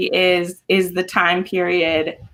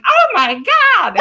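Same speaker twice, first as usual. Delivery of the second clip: slow, high, loud gasp of mock outrage; mock shock; 11 semitones higher, 5 dB louder, slower than usual. Recalling her first reading, in loud mock shock. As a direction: draw out a hammed-up shocked gasp.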